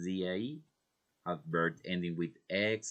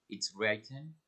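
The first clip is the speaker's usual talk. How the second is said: usual speaking voice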